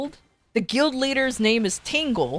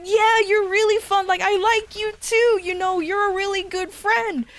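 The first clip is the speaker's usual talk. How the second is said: cheerful retold reply; 10 semitones higher than usual. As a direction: gush it happily. Retelling cheerful friend reply.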